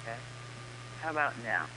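faint call-line voice; too quiet. Real, not acted. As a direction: talk as if the mic slipped away. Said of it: "Now you're very quiet".

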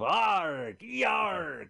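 slow mock pirate arrr; a bit of a cartoon pirate; pirate growl. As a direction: ham up a stage pirate's yarg. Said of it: "when we see pirate".

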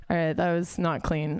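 usual speaking voice

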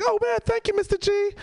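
high-pitched mock child's voice; excited little kid. Kid thanking the teacher for tying his shoes, high and eager. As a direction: imitate an eager schoolboy, way up high. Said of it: "he was like".

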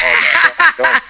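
real laugh breaking through the words; embarrassed laughing protest. Real, not acted. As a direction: laugh out the protest, pitched high.

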